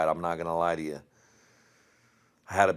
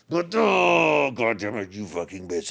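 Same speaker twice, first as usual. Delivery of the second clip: mock shout; 3 semitones higher, 5 dB louder, slower than usual. Joking threat at his wife, loud playful yell. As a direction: bellow it then laugh it off.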